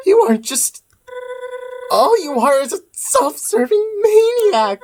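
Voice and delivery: bitter accusing character, rising to panicked cries for help. Acted. Game character accusing the player, then pleading for help. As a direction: start resentful, then break into desperate pleas. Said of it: "I gotta do the voice now".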